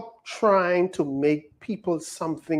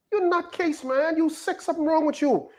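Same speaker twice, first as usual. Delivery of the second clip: mocking scold; 10 semitones higher than usual. Mocking those who judge him.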